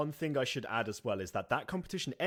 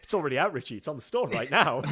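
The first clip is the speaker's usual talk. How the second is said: higher, loud, laughing insistence; amused correction; 5 semitones higher, 9 dB louder, faster than usual. Real, not acted. Correcting a co-host about the release date, amused and loud. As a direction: tell them loudly through laughter.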